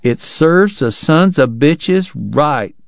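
grumbling Southern stranger in a telegraph office; bitter Southern townsman. Acted. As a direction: spit it out like a sore Confederate. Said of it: "someone remarked".

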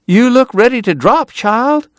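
high-pitched fussing Southern lady; crying out in concern. A narrator's reading. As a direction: exclaim it high, full of motherly alarm. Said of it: "she cried".

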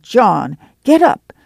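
mother rousing the household; calling out. A narrator's reading. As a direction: call the sleepers up out of bed. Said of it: "she called".

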